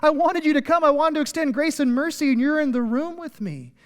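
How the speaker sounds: high, loud joyful welcome, easing at the end; ecstatic yell. Acted. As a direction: burst out overjoyed at their arrival. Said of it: "Like, God's going".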